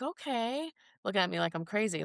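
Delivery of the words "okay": high skeptical okay; a puzzled, unsure okay; mock-doubtful okay. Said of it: "And they're like".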